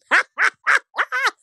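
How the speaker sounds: high, loud burst of laughter; delighted high cackle; laughing. Real, not acted. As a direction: laugh hard at your own joke. Laughs at own rhyme.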